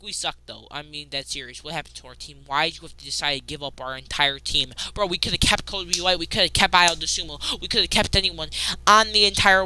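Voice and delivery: ordinary delivery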